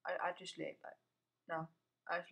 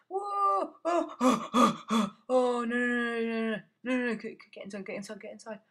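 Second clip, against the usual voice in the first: loud frantic no no no; frightened yelling while fleeing; panicked; 4 semitones higher, 8 dB louder than usual. Real, not acted. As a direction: panic and scramble for cover. Panicked at attack.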